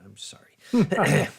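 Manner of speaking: slow unvoiced whisper of "sorry"; whispered apology. Streamer whispering a mock sorry to a chatter. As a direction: breathe a mock apology slowly.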